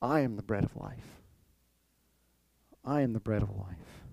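soft solemn declaration; quiet solemn Jesus. Retold or imitated. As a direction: let it drop to a hush, solemn. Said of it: "He says, "Believe in me".

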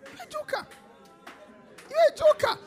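very high falsetto jeer; high mocking squeal. Preacher ridicules the joker, squealing very high. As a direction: squeal it in mock disbelief.

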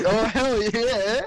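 loud, slow, high excited cheer; drawn-out hell yeah. Real, not acted. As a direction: drag it out with real excitement.

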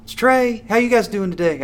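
very high, chirpy telemarketer voice; telemarketing twenty-two-year-old. Acted. Mocking old job, telemarketing twenty-two-year-old voice. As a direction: greet callers like an over-eager young telemarketer. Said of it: "sound like a telemarketing twenty-two-year-old".

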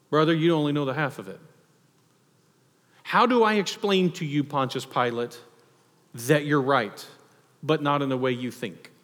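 ordinary talk

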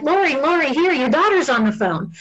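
acted bustling care worker breaking news; hurried staff member. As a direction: call the name insistently, pitched well up. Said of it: "And then I'll be like".

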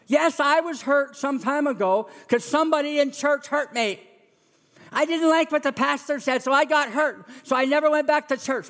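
high, whiny excuse-making; a hurt churchgoer justifying staying away; bitter churchgoer. Retold or imitated. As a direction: whine out the excuse. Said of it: "Some people say".

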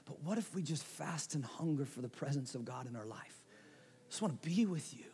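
quiet prayer. Acted. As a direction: pray it softly, longing to be near. Prays to God mid-sermon.

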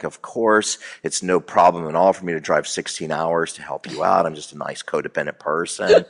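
mock a sweet people-pleaser; mild Midwesterner. Imitating mild Midwestern people, meek and overly nice. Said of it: "they're very mild".

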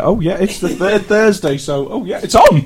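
high, loud hammed-up hype; mock excitement. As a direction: build from high to a shrieked it's on. Mocking excited announcement.

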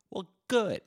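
sudden high, loud put-on voice; mock high retort. Host answering his own complaint, high and loud. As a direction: snap back at himself in a silly voice.

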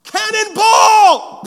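slow, high, joyful yell; long gleeful cannonball cry; cannonball shout. Retold or imitated. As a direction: shout it like a kid leaping in. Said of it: "would look something like this".

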